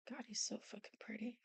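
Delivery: breathy whispered aside. Real, not acted. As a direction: whisper it to yourself. Admiring drawing, unvoiced.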